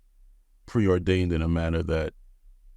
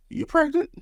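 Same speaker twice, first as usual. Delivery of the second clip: pitched far up, playful; teasing husband, high question. Retold or imitated. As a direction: toss it up high like a joke. Retold teasing question to wife.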